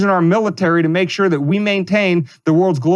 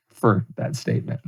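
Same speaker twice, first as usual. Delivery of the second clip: laughing through the words, quieter; amused wind-down with a laugh; 9 semitones lower, 7 dB quieter than usual. Real, not acted. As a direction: chuckle it out, calming down after the rant.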